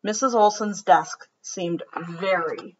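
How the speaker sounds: gentle storybook read-aloud; children's story narration. A narrator's reading. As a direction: read it like bedtime story time. Reads picture book aloud.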